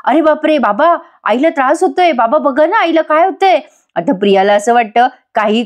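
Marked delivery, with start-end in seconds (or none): 0.0-3.6 mock alarmed yelling. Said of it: "मोठ्या मोठ्याने ओरडायला लागते".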